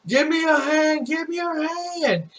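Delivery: high-pitched plea, stretched at the end; imitated calling out. Fallen fireman begging for a hand, calling out high. Retold trapped man calling for help.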